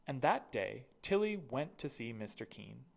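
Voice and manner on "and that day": ordinary delivery, nothing marked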